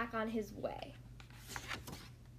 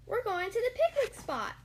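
high, loud little Roo; eager youngster announcing a treat; pitched way up, bursting with it; excited cry; 9 semitones higher, 5 dB louder than usual. A narrator's reading. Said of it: "cried Roo".

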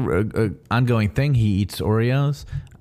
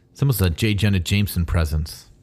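ordinary talk

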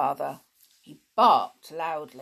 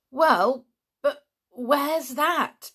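put-on higher, slow, pressing boy's demand; 8 semitones higher, 6 dB louder, much slower than usual. Dick demanding to know where his uncle hides, pressing. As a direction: push him for an answer. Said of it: "demanded Dick".

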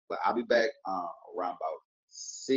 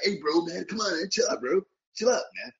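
imitating a viewer; 11 semitones higher, 6 dB louder than usual. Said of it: "trying to shoot me like".

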